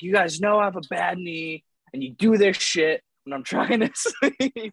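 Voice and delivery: a hint of a sleepy outburst, breaking into a laugh; complaining to roommates about the knee, mock annoyed; mock angry complaint. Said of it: "I'm like, I'm like".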